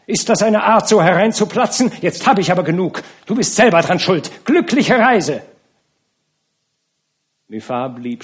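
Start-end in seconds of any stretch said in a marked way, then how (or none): 0.1-5.4 high, loud angry yelling; furious shout. A narrator's reading. Nana screams at the count through the slammed door, furious. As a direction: slam the door on him with a scream. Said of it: "Sie schlug die Tür zu und schrie".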